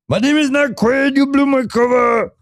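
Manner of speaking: very high, mock-outraged little character; put-on high character voice. The figure in the belly complaining its cover is blown, high put-on voice. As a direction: pipe up in a silly high voice.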